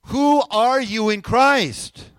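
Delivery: slow, very loud rallying question; rousing call. Real, not acted. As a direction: belt out each word to get the room answering. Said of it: "let's try it again".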